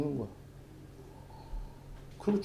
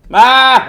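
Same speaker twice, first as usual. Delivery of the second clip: loud, high imitated bleat of a sheep; a child's mock sheep cry, loud and high; mournful sheep bleat; 10 semitones higher, 15 dB louder than usual. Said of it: "이렇게 엄청 구슬프게 계속 울어".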